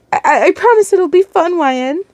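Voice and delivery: high, stammering promise; nervous persuading. A narrator's reading. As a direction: coax hopefully, stumbling at the start. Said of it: "Norman tried to convince you in his own way".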